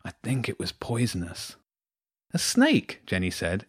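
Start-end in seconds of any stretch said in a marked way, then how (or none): 0.0-1.6 soft, pained murmur; half whisper, half groan it. A narrator's reading. Injured James confiding in Jenny, part whisper, part groan. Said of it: "It was part whisper and part groan". 2.3-3.0 high-pitched disbelief, normal volume; incredulous. A narrator's reading. Jenny reacting to James's snake encounter, incredulous.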